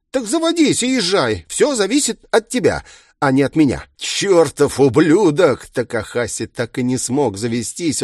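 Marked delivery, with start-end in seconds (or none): 0.1-3.8 high, insistent villain voice; taunting villain. A narrator's reading. Villain taunting trapped inspector. 4.0-5.6 loud, high, drawn-out curse; furious curse. A narrator's reading. Trapped inspector curses the villain.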